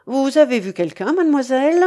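plain reading voice

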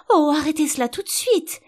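high, loud desperate plea; crying out. A narrator's reading. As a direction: exclaim it in despair. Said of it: "s'écria la reine".

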